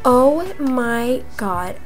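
ordinary delivery

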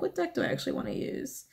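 breathy whisper to herself; soft unvoiced musing; 1 whispered thought. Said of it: "I'm like".

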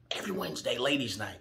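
low, soft aside; hushed repeat. Real, not acted. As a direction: drop right down in pitch and volume. Storyteller repeats detail as aside.

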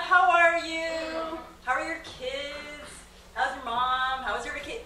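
slow, high, drawn-out caring tone; mock caring questions. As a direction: lay on the sincere concern, slowly.

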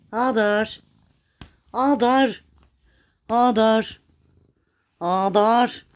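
loud, high call of the name; calling the cat. Real, not acted. Owner calling her cat Hadar, loud and high. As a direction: call the cat from across the room.

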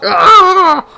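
very high startled cry; startled scream. Real, not acted. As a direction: yelp in alarm. Cry at a fall.

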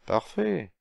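sudden high, pleased exclamation; delighted. Acted. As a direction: savor the perfect answer. President pleased with the plan.

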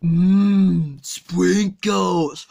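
put-on low slurred drunk voice; sluggish, drunk and lazy; Homer Simpson. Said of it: "Homer, pay attention".

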